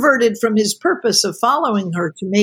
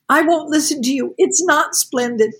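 higher, near tears and pleading; a young woman close to crying, pushing him away; almost crying; 6 semitones higher than usual. A narrator's reading. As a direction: hold back tears while refusing him. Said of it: "almost crying".

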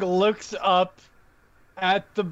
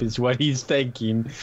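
normal talking voice

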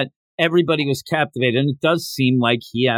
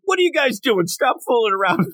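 higher mock scolding; irritated dad; 8 semitones higher than usual. Dad telling the kids to stop fooling around, mock irritated. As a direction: snap at the kids like an annoyed father. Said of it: "the dad seemed so irritated".

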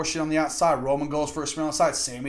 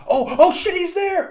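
high, loud mock shout of surprise; imitating a startled, excited crowd; shocked crowd reaction; 8 dB louder than usual. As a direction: burst out like a fan caught off guard.

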